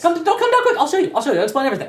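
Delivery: very high, pushy beckoning; urgent NPC. Acted. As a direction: hurry them down, promising answers. NPC urging them down.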